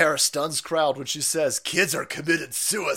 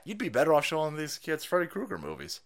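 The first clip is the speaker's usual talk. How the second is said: ordinary talk, nothing marked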